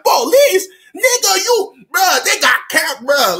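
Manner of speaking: high, loud alarm; an alarmed outcry; alarmed yell. Retold or imitated. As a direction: shout back in panic. His alarmed reply.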